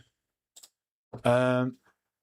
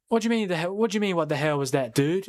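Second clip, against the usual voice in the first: higher-pitched mock-American protest; American accent; 5 semitones higher than usual. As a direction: put on an indignant American dude. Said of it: "that's my American accent".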